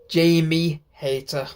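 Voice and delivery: ordinary talk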